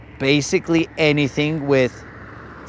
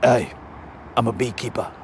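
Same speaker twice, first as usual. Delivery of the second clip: low, soft English tough guy; English accent; 8 semitones lower, 6 dB quieter than usual. Acted. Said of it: "You're butchering the English accent".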